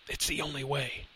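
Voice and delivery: soft, faint urging; shallow breaths. A narrator's reading. Professor handing Lenny the knife, faint shallow breaths. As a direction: press him weakly, on little breath. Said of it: "he said with shallow breaths".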